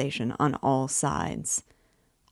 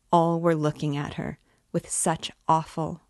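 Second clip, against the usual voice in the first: plain reading voice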